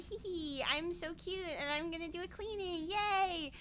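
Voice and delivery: soft, cutesy little voice; giggly-cute and pleased with yourself; cutesy maid. Acted. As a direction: play it cute, softly. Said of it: "like, a cute thing. Like".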